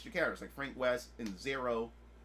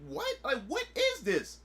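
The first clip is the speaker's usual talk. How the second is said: baffled; 10 semitones higher, 3 dB louder, slower than usual. Retold or imitated. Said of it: "I'm like".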